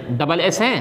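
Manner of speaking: ordinary delivery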